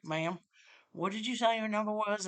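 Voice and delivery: put-on caricatured Florida accent, polite and puzzled; mock Florida accent. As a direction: imitate a local with a thick Florida accent. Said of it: "The worse the accent gets".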